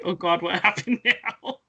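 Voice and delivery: mock dismay broken by a laugh; laughing retold dismay. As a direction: quote the husband's dread while laughing. Husband's weary reaction, retold with laughter.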